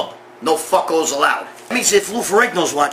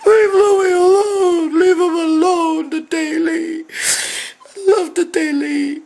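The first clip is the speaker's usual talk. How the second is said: mock crying fan; 14 semitones higher, slower than usual. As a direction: wail it like a heartbroken superfan begging. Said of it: "You guys, I know some of you guys love".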